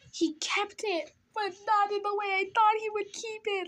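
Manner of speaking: high-pitched hammed-up wail; gasp, then wail in mock heartbreak; retold distraught. Teller reliving the note being read out, distraught. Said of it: "I was distraught".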